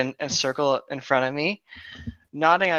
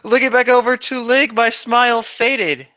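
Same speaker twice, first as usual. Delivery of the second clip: higher, louder alarmed gasp; sudden gasp; 9 semitones higher, 5 dB louder than usual. Acted. Narrator seeing her trampled son, alarmed gasp.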